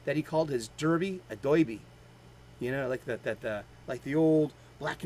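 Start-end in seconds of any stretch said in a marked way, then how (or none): 1.4-1.8 put-on "doiby" in an old Gotham accent, "oi" for "er"; old New York "oi" vowel in "doiby". Shown as a demonstration. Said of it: "had such a thick old Gotham accent".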